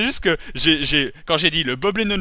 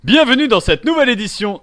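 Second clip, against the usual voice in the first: louder presenter greeting; show host intro; 4 semitones higher, 5 dB louder than usual. Acted. Host opening the new edition, loud and upbeat. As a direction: welcome viewers like a TV host.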